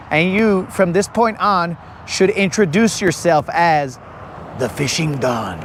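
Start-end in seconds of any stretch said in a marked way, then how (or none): 4.6-5.6 low mafia-boss voice; Italian mobster. Acted. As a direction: introduce the mob don. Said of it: "do it in that voice".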